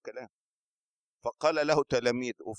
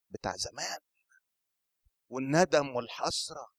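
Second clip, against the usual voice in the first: ordinary talk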